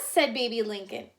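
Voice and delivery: usual speaking voice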